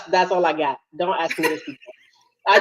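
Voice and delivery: ordinary talk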